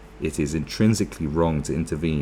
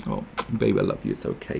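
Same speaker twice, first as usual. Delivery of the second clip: soft, low mock reassurance; soothing cuddle; 9 semitones lower, 4 dB quieter than usual. Acting a man trying to cuddle his partner, soothing. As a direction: coax an angry partner very gently. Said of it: "you try and cuddle her".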